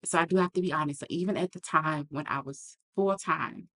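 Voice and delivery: usual speaking voice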